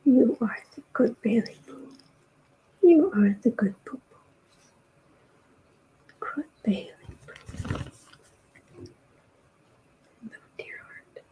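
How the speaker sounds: tender soft whisper; whispering to cat. Real, not acted. Owner whispering tenderly to her cat. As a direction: murmur sweet nothings.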